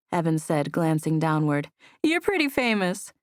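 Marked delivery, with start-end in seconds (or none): 2.0-3.1 teasing. A narrator's reading. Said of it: "Annabelle teased".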